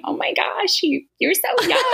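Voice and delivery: high, giggling exclamation through laughter; a giggly, high-pitched gasp of amused disbelief; laughing. Retold or imitated. Retold nervous thought, laughing.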